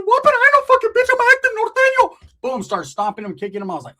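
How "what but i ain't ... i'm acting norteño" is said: very high, loud indignant voice; shrill Ricky. Acted. Ricky answering Roach's insult, shrieking. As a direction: shriek it in outrage. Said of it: "Ricky said".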